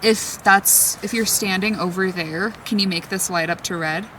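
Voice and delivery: ordinary talk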